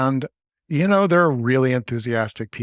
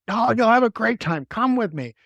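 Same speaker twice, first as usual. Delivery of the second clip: pushy enthusiasm; 10 semitones higher than usual. Pretend. Friend dragging you along.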